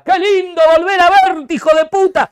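high, loud mock TV commentator voice; mock TV announcer. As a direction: ham up the pompous commentator greeting. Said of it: "apenas escucha". (imitando al relator con voz alta y fuerte)

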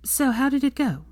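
high, eager, nervous question; excited and nervous. A narrator's reading. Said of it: "with excitement and nervousness in her tone".

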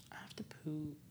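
barely audible whisper; whispered aside. Real, not acted. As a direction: whisper it as a private confession. Whispered aside during a lull.

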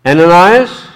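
a loud, high call across a distance; Ananias?, called loudly with a rising tune. Acted. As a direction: call his name to wake him. The Lord calls out in vision.

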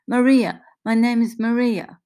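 put-on high, loud Italian-accented introduction; emphatic, animated Italian accent; thick Italian accent. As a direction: announce yourself eagerly with an Italian accent. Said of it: "she asks in a thick accent".

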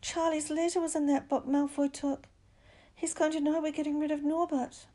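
high-pitched, panicked hoarse voice; hoarse alarm. A narrator's reading. Boy tells friends of the lost letter, panicked. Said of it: "he said in a hoarse voice".

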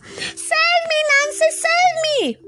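very high, panicked rat squeal. A narrator's reading. Rat flees the furious Tiger, squealing to Anansi for help. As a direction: shriek for help like a cornered rat. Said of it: "squealing to Anansi".

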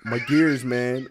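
ordinary delivery

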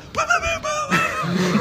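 squeaky high-pitched laugh; high strangled laugh. Retold or imitated. Upside down, can't breathe.

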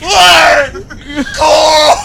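anguished mock shout, high and loud; exaggerated imitated yell; Rick Grimes. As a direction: wail the name out, hammed up. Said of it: "Rick Grimes".

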